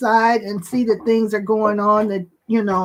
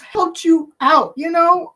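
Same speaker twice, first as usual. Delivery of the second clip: overcome with emotion; 4 semitones higher than usual. Real, not acted. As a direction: voice climbing high toward tears. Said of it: "I don't even know what's wrong with me".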